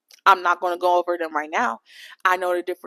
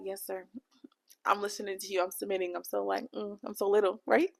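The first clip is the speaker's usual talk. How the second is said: whispered, much softer submissive yes sir; 10 dB quieter than usual. Pretend. Playing soft and submissive to a guy, hushed meek whisper. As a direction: shrink your voice, meek and little. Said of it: "so it could be like I'm soft".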